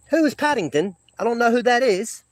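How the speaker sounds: English accent. Shown as a demonstration. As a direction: stay in your English impersonation, puzzled. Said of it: "English accent, uh, impersonation".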